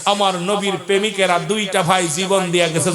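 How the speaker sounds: high, chanting sermon delivery; impassioned preaching. Acted. Preacher tells of paradise readied for two boys, high fervent chant. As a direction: keep the fervour ringing, easing at the end.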